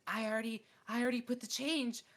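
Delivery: high panicked mock cashier; panicked worker. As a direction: play the flustered new girl losing it. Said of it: "She was like".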